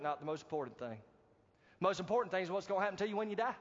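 ordinary delivery

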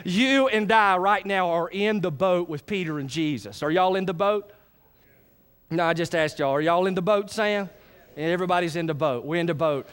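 ordinary talk, nothing marked

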